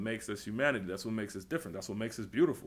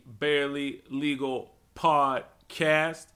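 put-on slow drawn-out Robbie accent; 2 semitones higher, 5 dB louder, slower than usual. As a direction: stretch the outro in the Robbie accent. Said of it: "this is the Robbie accent".

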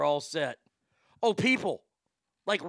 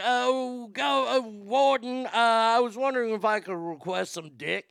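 higher-pitched put-on inmate asking the warden; mock prisoner; 5 semitones higher than usual. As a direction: imitate a prisoner making a request, hammed-up. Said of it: "this gal's wanting more".

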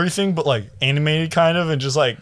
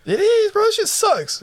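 squeaky high voice; slower than usual. Real, not acted. Mocking Facebook, voice jumps high.

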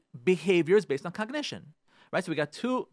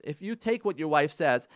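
normal talking voice

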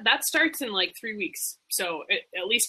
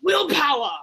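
excited; 5 semitones higher, 4 dB louder than usual. Acted. Excited echo of the name.

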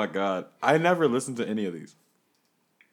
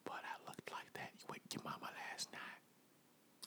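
whisper; 24 dB quieter than usual. Acted. Whispered joke.

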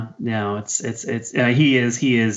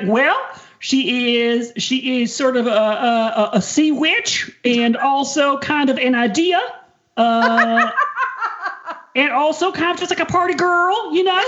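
much higher, dreamy [name] voice breaking into giggles; giggly high imitation of [name] at a press junket; [name] impression. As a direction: play [name] gushing about her character, pitched way up. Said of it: "the video of [name] trying to describe her character".

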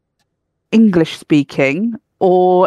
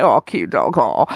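much lower, half-hearted cooing at a dog; awkward polite cooing; 9 semitones lower than usual. Retold or imitated. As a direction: fake politeness to a stranger's dog. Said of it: "just make noises at them like".

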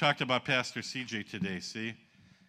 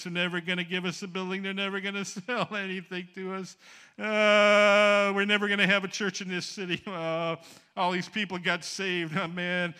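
mock crying; 5 semitones higher than usual.

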